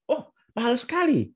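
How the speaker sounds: high-pitched, instant startled reaction; shocked at the price, straight away; spontaneous surprise. Shown as a demonstration. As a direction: blurt it out in surprise, no hesitation. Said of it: "spontanitas seperti tadi".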